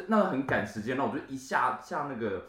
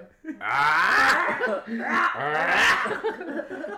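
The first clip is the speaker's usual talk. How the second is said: loud shouting laugh; 10 semitones higher, 4 dB louder than usual. Real, not acted. Loud laughter after joke.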